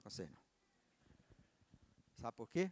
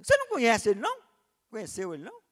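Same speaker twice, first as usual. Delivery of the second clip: indignant challenge; 10 semitones higher, 17 dB louder, faster than usual. Retold or imitated. Son challenging God, indignant.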